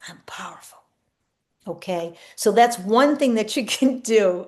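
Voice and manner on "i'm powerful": much softer breathy whisper; hushed whisper of power; whispered affirmation. Shown as a demonstration. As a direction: let it out as a secret of strength.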